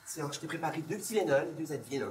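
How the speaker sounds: lightly put-on Parisian French accent. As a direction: talk like a Parisian French local. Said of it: "avec un accent parisien".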